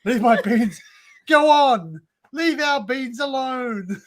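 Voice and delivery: hammed-up telling-off; mock indignant yell. Streamer jokingly defends their beans, mock indignant yell.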